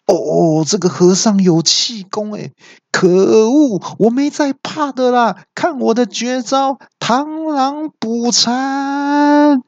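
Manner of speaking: very high, slow, drawn-out boast; stung villain bragging through the pain; villain bluster. A narrator's reading. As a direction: puff up and announce the move. Villain boasting mid-fight.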